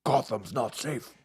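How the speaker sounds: lightly voiced gravelly Batman; slow brooding hero growl, lightly done. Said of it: "No Batman reference?".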